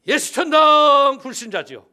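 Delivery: loud chanted call with a falling end on 천당; street evangelist cry. Retold or imitated. Young pastor preaching in the street, loud chanted call. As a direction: call it out like beating a drum in the street. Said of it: "이러고 전도할 때예요".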